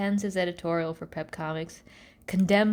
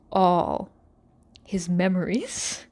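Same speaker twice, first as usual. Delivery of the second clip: normal talking voice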